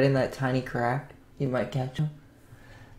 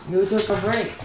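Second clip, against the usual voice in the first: put-on baby talk; 7 semitones higher than usual.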